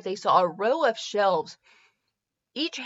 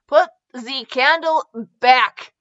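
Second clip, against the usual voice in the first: called out; 4 semitones higher, 6 dB louder, slower than usual. Acted. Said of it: "he called".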